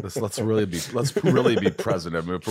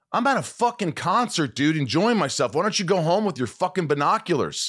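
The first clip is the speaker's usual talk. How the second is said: high, heated yell at the people behind him; mock-furious rant, pitched way up; retold angry outburst; 10 semitones higher than usual. Retelling how he snapped at hecklers at a concert, high and angry. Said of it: "I get mad".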